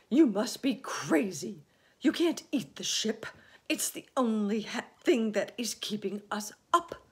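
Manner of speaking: loud, high protest; exasperated, full-voiced objection; gloomy earthworm, indignant. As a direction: argue back in alarm. Earthworm objects to eating peach.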